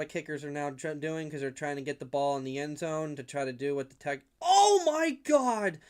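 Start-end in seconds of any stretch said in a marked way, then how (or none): 4.4-5.8 shocked outburst. Real, not acted. Shocked by a hit on screen.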